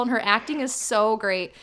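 ordinary delivery

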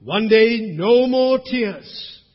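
loud, high, drawn-out preaching cry; triumphant proclamation. Real, not acted. Preacher proclaims the end of tears to congregation, triumphant. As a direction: proclaim it slowly at full voice.

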